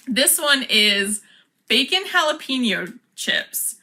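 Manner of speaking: ordinary delivery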